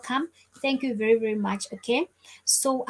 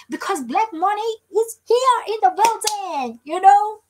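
excited, pitched-up fanfare; hyped-up announcement; 9 semitones higher, 5 dB louder than usual. Pretend. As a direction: announce a guest's arrival like a hype host. Hyped announcement of a viewer's arrival.

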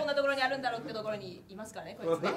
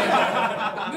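loud real laugh; 2 semitones lower, 13 dB louder than usual. Real, not acted. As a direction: crack up. Laughing at own gag.

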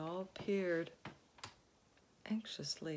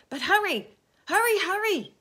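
high, loud frantic urging; urgent; 13 semitones higher, 15 dB louder than usual. A narrator's reading. Centipede frantically urging James. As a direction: rush him. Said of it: "said the centipede".

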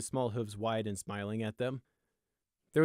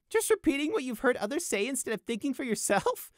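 high, incredulous echo; gleeful high-pitched parroting; giggling mockery; 4 dB louder than usual. A narrator's reading. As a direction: quote it back to ridicule him. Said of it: "Prey giggled harder".

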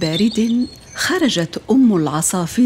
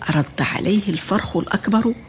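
usual speaking voice

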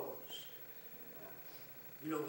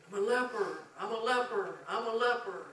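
high, loud desperate cry of a leper; loud, high, anguished shout from a distance; hollered plea; 10 semitones higher, 9 dB louder than usual. Retold or imitated. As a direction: holler it from far off, pleading. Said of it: "they had to holler".

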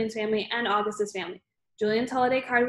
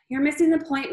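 exasperated mother's raised, higher voice; restrained narrated yell, louder and higher; 5 semitones higher, 5 dB louder than usual. A narrator's reading. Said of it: "Mom yelled".

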